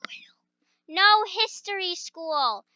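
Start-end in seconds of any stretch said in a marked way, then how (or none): 0.1-0.9 whispered aside; whispered confession. Real, not acted. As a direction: breathe it secretly. Secret aside.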